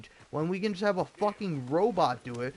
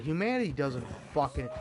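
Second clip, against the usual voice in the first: normal talking voice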